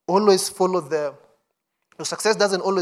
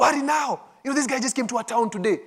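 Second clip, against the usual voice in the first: quick, higher, pressing hurry; urgent; 6 semitones higher, faster than usual. Shown as a demonstration. Preacher replaying the women's warning, fast and urgent. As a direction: push them to hurry, fast and high. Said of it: "a sense of urgency".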